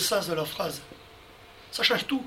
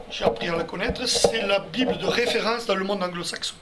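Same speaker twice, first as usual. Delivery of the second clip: normal talking voice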